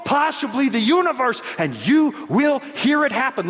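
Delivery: high-pitched emphatic rhetorical preaching; impassioned preaching. Real, not acted. Preacher challenges congregation about second coming, impassioned high preaching. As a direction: build questions toward a big declaration.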